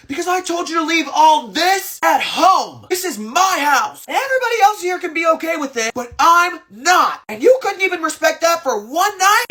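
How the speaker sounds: high, furious retort from the head of the table; angry snapping back. Retold or imitated. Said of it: "he immediately snaps back".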